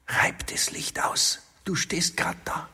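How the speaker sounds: hushed unvoiced whisper. A narrator's reading. Woman whispering to her lover in the dark bedroom. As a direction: breathe it in a secret whisper.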